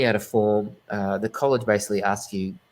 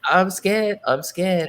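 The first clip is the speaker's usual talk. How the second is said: higher, louder retold panic; mock fright; 9 semitones higher, 4 dB louder, much slower than usual. Student recalling the shock of landing in trance class, mock fright. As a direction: act out being scared, raised voice.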